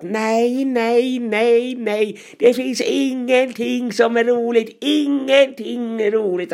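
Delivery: hammed-up despairing bellow through a door; muffled roaring. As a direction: wail it out like a critic locked in a bin room. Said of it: "så här dovt vrålat".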